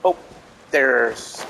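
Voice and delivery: ordinary talk, nothing marked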